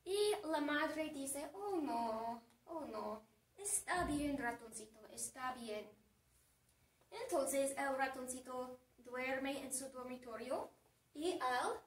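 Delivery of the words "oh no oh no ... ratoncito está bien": low, soft motherly comfort; gentle, lowered consoling voice; soothing mother. Acted. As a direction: hush and reassure a crying little one. Mother comforts crying little mouse.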